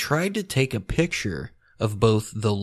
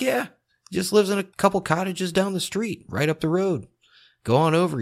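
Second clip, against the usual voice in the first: higher, brighter voice pitched well up; eager young Winotka; 9 semitones higher than usual. Retold or imitated. Winotka urging the reporter to visit his father, pitched high. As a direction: lift the voice way up like an eager kid. Winotka urges Nash to go over.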